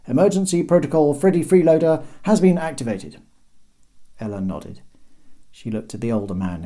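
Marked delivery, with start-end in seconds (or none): 0.1-3.2 higher, louder crisp report; efficient deputy voice. Acted. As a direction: report it like a duty officer.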